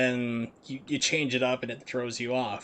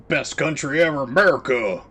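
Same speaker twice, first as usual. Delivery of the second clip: loud hammed-up shout of national pride; mock patriotic cheer; 2 semitones higher, 8 dB louder than usual. Streamer hams up pride in America with a loud mock cheer. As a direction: belt it like a cheering fan at a rally.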